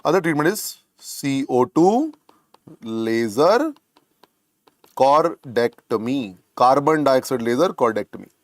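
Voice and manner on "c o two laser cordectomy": slow, with pauses between the parts; slow dictation. Real, not acted. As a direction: dictate it piece by piece for note-takers. Dictating a term for note-taking.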